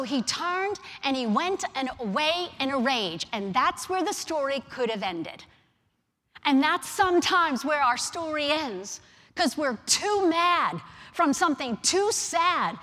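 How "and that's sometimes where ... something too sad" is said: loud, driving sermon delivery; forceful preaching. Real, not acted. Preacher warns the congregation about walking away angry, forceful preaching. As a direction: preach it hard, building in volume.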